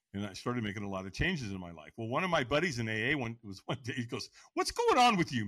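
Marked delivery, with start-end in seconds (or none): 4.5-5.4 retold amazed question, pitched way up; astonished buddy. Buddy asks about the teller's changes, astonished. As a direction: quote a friend who can't believe it.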